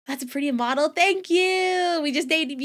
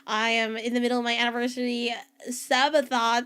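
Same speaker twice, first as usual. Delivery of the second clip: usual speaking voice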